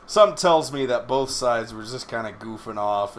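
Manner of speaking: ordinary delivery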